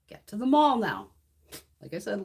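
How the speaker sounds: ordinary delivery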